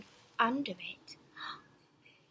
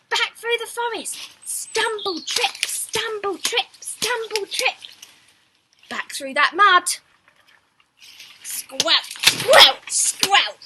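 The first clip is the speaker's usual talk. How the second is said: loud, high, hurried retreat; frantic dash; 7 semitones higher, 12 dB louder than usual. A narrator's reading. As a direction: race back, breathless and loud. Frantic run home.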